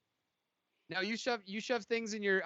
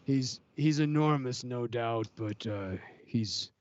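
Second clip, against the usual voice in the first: grim fighter voice; 6 semitones lower, slower than usual. Acted. Gladiator character voice.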